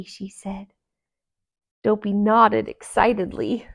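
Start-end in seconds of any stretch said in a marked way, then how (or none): none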